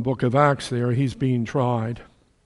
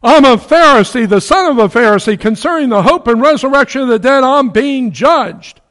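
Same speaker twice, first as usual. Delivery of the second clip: high, loud cry to the council; Paul crying out before the council; cried out; 11 semitones higher, 10 dB louder than usual. A narrator's reading. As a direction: proclaim it loud and high, slowing at the end. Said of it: "he cried out in the council".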